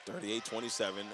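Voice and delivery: usual speaking voice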